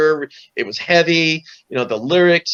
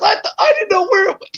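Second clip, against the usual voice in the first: mock crying wail. Brother Chris caught out on stage, hammed-up crying wail. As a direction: wail it like a caught-out kid in fake tears. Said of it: "He goes".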